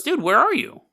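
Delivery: startled high question; surprised. Retold or imitated. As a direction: sound caught off guard. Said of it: "she goes".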